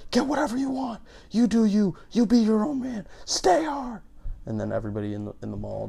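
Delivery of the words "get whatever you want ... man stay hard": loud, high-pitched mock motivational scream; David Goggins screaming. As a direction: scream it like an over-the-top motivational hardliner. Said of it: "he just screams at her".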